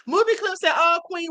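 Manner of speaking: high, laugh-tinged reading; amused laughing. Real, not acted. Host reading a kind viewer comment that made her laugh, laughing. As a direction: read it out while breaking into a laugh. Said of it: "You just made me laugh".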